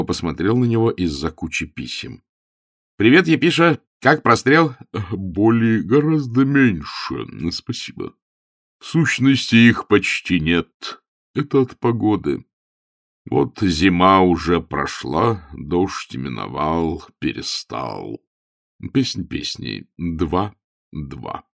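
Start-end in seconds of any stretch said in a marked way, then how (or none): 3.0-4.7 high, loud, cheery hello; bright-spirited secretary calling out; breezy greeting. Acted. 5.1-21.4 put-on slow, measured old cleric; hesitant, deliberate bishop's voice; troubled bishop.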